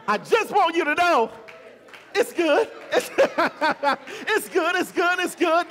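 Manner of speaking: excited refrain. Acted. Preacher's climax, shouting refrain.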